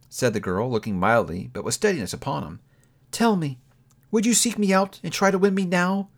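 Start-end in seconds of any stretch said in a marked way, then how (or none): 3.1-6.1 put-on a lightly higher young woman, sad and steady; a hint of a hurt young woman letting go; Belle, lightly voiced. A narrator's reading. Young woman to her changed lover, lightly voiced and sorrowful.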